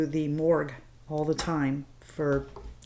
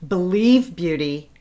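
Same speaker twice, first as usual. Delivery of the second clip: usual speaking voice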